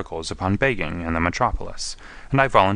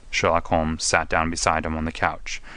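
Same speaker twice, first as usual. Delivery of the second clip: usual speaking voice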